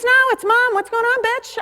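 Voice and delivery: very high imitation of a chipper mom; high-pitched, playful mom voice; mom trying swearing. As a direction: imitate a mom proudly testing a swear word. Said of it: "I'm trying out the swearing thing".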